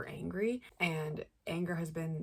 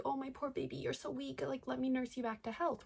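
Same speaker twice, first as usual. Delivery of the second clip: mock sweet mother; 8 semitones higher than usual. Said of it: "Mother's returned and been like".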